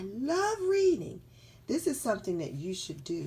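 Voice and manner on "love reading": high, slow, gushing reader; enthusiastic character voice. Acted. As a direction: gush in a high, drawn-out voice.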